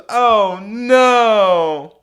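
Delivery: high, loud, drawn-out wail; imitating a crushed drunk woman; mock dismay. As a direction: wail it in hammed-up disappointment.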